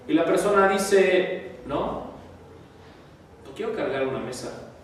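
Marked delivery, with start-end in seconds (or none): 1.7-4.7 softer, grudging no to the table; a mild mock sulk; sulky refusal. Angry person refuses to help. (negativa desganada y suave)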